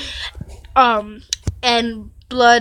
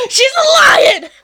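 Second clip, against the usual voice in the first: high, loud laughing yell, drawn out; laughing shout; 7 dB louder, slower than usual. Real, not acted. Reader yelling her delight at viewers, laughing and high. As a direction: shout it out, cracking up with glee.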